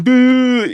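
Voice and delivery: high drawn-out "boo"; mock the crowd with a long high hoot; loud-ish, high mocking boo; imitated jeering noise. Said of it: "everyone was going".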